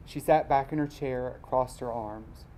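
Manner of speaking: ordinary delivery, nothing marked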